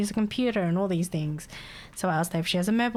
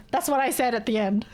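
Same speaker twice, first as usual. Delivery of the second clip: ordinary talk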